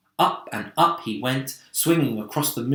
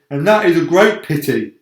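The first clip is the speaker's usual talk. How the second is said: dry earthworm; 4 semitones higher, 9 dB louder than usual. A narrator's reading. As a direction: needle the noisy centipede. Earthworm continues retort.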